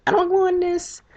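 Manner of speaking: put-on high, whiny little-girl refusal; mock scared cousin. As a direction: whimper it in a high kid voice. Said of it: "she's like".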